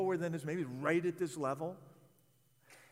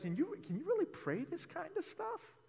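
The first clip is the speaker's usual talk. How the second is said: doubtful listener; 10 semitones higher than usual. Retold or imitated. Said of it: "And if you're thinking, like".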